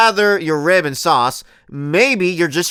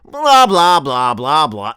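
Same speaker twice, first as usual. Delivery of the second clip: dismissive blah blah; 4 dB louder, slower than usual. Retold or imitated. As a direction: brush it off with blah blah. Mocking blah blah.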